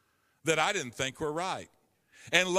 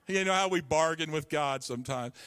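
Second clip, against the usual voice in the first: normal talking voice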